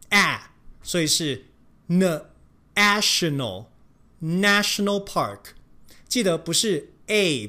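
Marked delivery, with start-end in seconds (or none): 1.9-5.4 national 的 a 念成短的 æ，慢慢念; 把 national park 慢速念清楚; slow national. Shown as a demonstration. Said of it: "national的A母音是发". (慢速示范 national)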